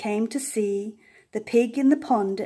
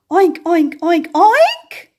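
much higher and louder oinking; loud excited oinks; 7 semitones higher, 9 dB louder, slower than usual. A narrator's reading. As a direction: oink with building glee. Pig's loudest grunts.

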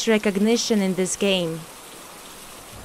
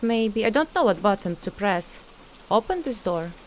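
normal talking voice

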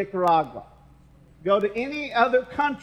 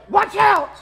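sudden loud high yell to scare; startling shout; 11 semitones higher, 8 dB louder than usual. Retold or imitated. Husband scaring his wife at the door, sudden loud yell. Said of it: "She screams like a werewolf".

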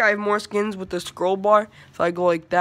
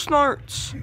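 ordinary delivery

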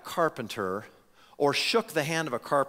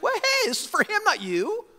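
much higher, put-on dismissive voice; mocking crowd voice. Crowd snubbing the rival at the convention, mock put-on voice. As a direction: brush off the loser in a silly voice.

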